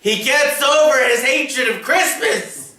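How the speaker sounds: high, exaggerated spoiler voice. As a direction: jump up in pitch for effect. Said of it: "his octave grew too".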